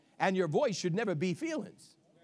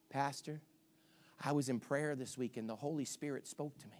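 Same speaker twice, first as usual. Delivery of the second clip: low and much softer; quiet earnest congregant; 8 semitones lower, 9 dB quieter than usual. Retold or imitated. Congregant telling the pastor about prayer, soft and low. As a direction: say it softly, dead earnest.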